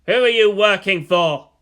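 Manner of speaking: loud interrogating demand; sharp demand. Acted. Gendo interrogating his son, loud and demanding. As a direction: bark the question at the boy.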